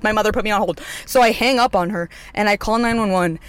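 normal talking voice

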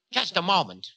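high-pitched objection; Time, objecting. A narrator's reading. Time interrupting Ravana's talk of love, objecting.

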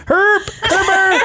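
high, slow mangled cry; garbled name call. Retold or imitated. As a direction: imitate the caller nobody understands. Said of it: "She'll know exactly what you mean".

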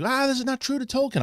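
high-pitched imitation of griping fans; mock complaint. Reviewer imitating upset Tolkien fans, high mock complaint.

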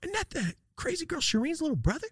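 high-pitched schoolkid chatter; mock high onlooker voice; gossiping onlookers. Voicing the watching schoolkids.

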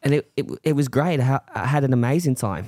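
Australian accent. Shown as a demonstration. An Australian accent on request, recounting Bondi Beach. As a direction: put on an Aussie twang. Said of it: "That's not bad".

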